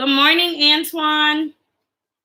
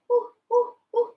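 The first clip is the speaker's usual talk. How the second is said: put-on much higher, soft baby monkey hoots; tiny monkey calling out; 9 semitones higher, 5 dB quieter than usual. Shown as a demonstration. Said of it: "Baby monkey".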